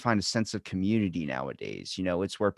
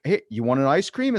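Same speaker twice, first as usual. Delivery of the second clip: higher, louder, upbeat invitation; bright-eyed, pitched-up friendly offer; eager offer; 5 semitones higher, 6 dB louder than usual. Acted. Acts out offering someone a treat.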